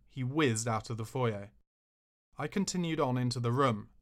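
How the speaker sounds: usual speaking voice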